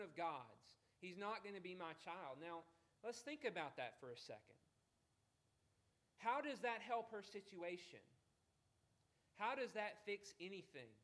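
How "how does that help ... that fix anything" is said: ordinary delivery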